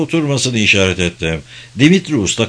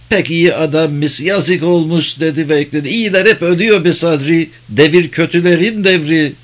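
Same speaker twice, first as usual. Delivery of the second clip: a slightly higher character voice for the tavern keeper; lightly voiced tavern keeper, regretful; 5 semitones higher than usual. A narrator's reading. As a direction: lightly play an old friend lamenting. Tavern keeper lamenting to old friend.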